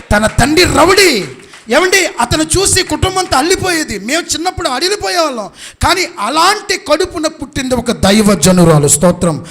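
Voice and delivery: loud, high preacher's shout; impassioned preaching. Real, not acted. Preacher telling of the rowdy father, impassioned shouting. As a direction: proclaim it to the whole congregation at full force.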